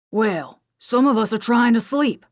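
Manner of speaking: an irritated Australian soldier calls out from his bunk; annoyed Australian call-out. Acted. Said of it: "an annoyed, accented voice called out".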